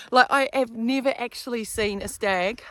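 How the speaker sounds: usual speaking voice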